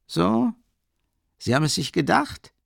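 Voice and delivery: surprised. A narrator's reading. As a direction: pitch jumps up on the opening "So?". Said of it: "fragte Swidrigailow verwundert". (verwundert, mit deutlich hoch ansetzender Stimme)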